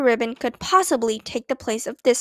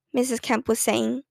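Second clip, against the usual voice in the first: ordinary delivery, nothing marked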